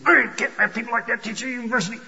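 high, fast huffy outburst; huffy, angry mock. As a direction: mimic someone indignant, rushing it in a high voice. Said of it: "get all huffy and angry and say".

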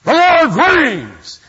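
The Lord reigns roared slowly, full force; furious roar. Retold or imitated. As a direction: bellow it like a furious reformer. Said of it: "in his typical Martin Luther way".

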